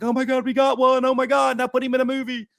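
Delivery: high-pitched, giddy mock freak-out; flipping-out fans. Host imitating toy fans flipping out over news, mock-excited. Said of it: "everybody was flipping out".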